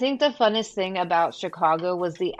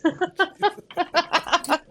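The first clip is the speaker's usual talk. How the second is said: laughing; 10 semitones higher than usual. Real, not acted. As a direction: crack up at a dirty joke. Cracking up at wiener joke.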